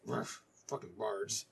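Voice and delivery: slow, grumbling dismissal; grumbling grump dwarf; grumpy dwarf. Acted. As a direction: sneer at bards under your breath.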